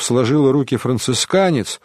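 plain reading voice

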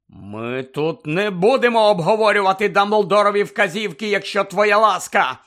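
loud, high, angry exclamation; exclaiming. A narrator's reading. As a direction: shout down the subject. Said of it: "вигукнув Сіріус".

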